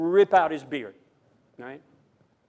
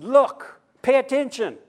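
raised, higher call to attention; urgent call; 9 semitones higher, 8 dB louder than usual. Acted. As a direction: jolt the congregation awake. Preacher voices what the text means.

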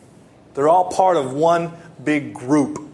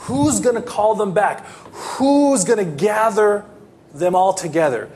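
ordinary delivery

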